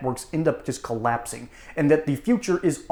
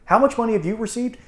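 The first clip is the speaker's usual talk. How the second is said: pushy reporter; 3 semitones higher, 4 dB louder than usual. Retold or imitated. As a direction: hammer him with reporter questions. Mock reporter questioning Biden.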